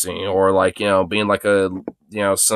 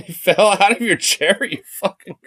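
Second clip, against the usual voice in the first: relieved laughter; 10 semitones higher than usual. Real, not acted. Laughing at the dog.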